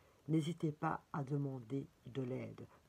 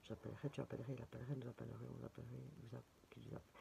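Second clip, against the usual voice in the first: much softer, low conjugation run; talking to herself, very soft; muttering to herself; 5 semitones lower, 15 dB quieter, much faster than usual. Real, not acted. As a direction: mutter the verb forms under your breath. Conjugating under her breath.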